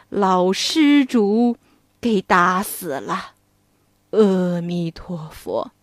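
a grieving master sending his pupil away; Tang Seng's slow, heavy farewell; sorrowful old monk. Acted. Voices the monk Tang Seng.

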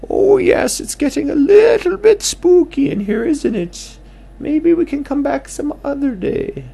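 high, loud owl character; squeaky owl friend. Acted.

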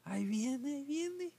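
muy agudo, chillando de pánico; grito agudo. Retold or imitated. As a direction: chilla que ya viene. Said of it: "empezaron a gritar". (grito agudo de pánico)

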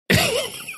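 very high squeaky giggle; high giggle before a naughty joke; laughter. Real, not acted.

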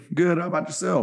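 match the country customer's energy; lightly put-on Southern friendliness; country greeting. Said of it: "I'm immediately like".

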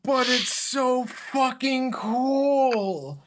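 high, loud, drawn-out fan enthusiasm; excited protest. Real, not acted. Fan defending Falcon's flying shield to a friend, excited protest. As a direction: gush it loudly, stretching every word.

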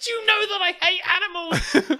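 high, loud put-on whine of a man who hates animals; exasperated father-in-law. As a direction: whine it like a fed-up grump. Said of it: "this guy's life is like".

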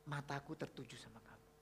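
low, very soft reassurance; hushed tenderness. Retold or imitated. God's letter assuring a lonely child, hushed and tender. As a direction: speak the promise quietly and gently.

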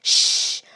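loud, sharp "shh"; a hard hiss for silence; sharp shushing. Acted. Hushing her sister as a car comes, sharp shush.

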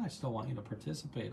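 ordinary talk

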